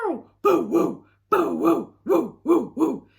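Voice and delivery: loud, high boo-woo howls; howling dog. Acted. Blitzer Maloney the dog howls, loud and high. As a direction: howl and bay like a hound. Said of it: "said Blitzer Maloney".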